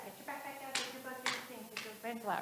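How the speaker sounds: very soft, run-together instructions; receding teacher patter. Acted. Teacher's orders going past a lost pupil, hushed patter. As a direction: rattle it off under your breath.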